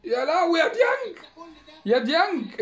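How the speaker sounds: high, loud wail of despair; real fan's howl of dismay; anguished cry. Real, not acted. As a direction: cry out at the player like it hurts. Despairing at defender's mistake.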